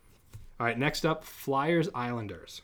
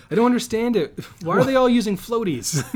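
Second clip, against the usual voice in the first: high, loud amused disbelief; picture hockey players in floaties; incredulous laughing; 10 semitones higher, 8 dB louder than usual. Pretend. Imagining outdoor rink players.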